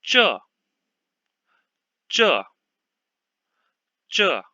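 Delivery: zhe in a clear falling fourth tone, each take spaced apart; carefully modelled Mandarin syllable. Shown as a demonstration. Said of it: "which one is the pinyin of".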